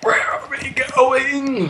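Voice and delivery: very high, slow squeaky voice; high silly voice. Pretend.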